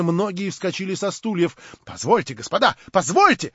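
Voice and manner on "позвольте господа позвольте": loud, rising plea to interrupt; shouting for attention. A narrator's reading. Lame man demands the gathering's attention, raising his voice. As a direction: cut in, louder on the repeat. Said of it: "кричал Хромой".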